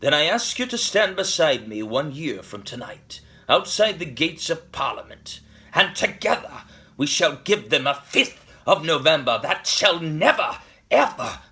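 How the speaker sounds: building to a loud, high-pitched vow; rally the crowd, slowing hard on the last words; rousing climactic oratory. Acted. Speech builds to its final vow.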